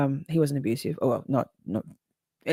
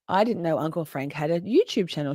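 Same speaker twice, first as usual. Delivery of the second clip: normal talking voice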